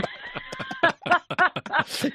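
a long, high peal of laughter; big high laugh. Real, not acted. Laughing hard at the recruits who came back changed, high peal. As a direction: let the laugh ring out high.

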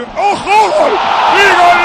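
huge drawn-out goal shout; shouted goal call. Real, not acted. Radio commentator sees the ball go in, roaring the goal. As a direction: break into a long, high, loud roar of gol.

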